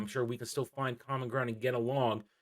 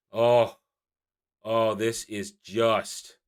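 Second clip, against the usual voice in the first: ordinary talk, nothing marked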